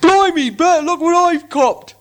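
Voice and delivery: troll William calling to Bert. Acted. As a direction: crow like a troll with a catch. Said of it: "said William".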